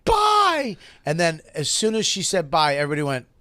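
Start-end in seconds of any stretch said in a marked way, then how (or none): 0.0-0.8 high little mock goodbye; girl saying bye to the table; parting bye. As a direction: chirp a wounded bye. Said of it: "she goes".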